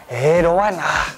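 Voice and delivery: reluctant Manglish refusal; slow whiny Malaysian English no; Manglish accent. Shown as a demonstration. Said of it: "When people force you to watch Twilight".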